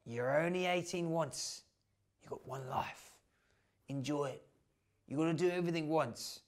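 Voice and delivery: soft, confiding Aussie mate; hushed pep talk from an Australian bloke; big Australian man. Acted. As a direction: coax him quietly, arm round his shoulder. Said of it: "big Australian man called Butch put his arm around him".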